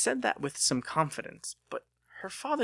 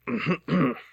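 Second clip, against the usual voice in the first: pointed, loud throat clear; 5 semitones higher, 5 dB louder than usual. Acted. Father interrupting the couple, loud pointed throat clear. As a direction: clear the throat to break them apart. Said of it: "her father cleared his throat".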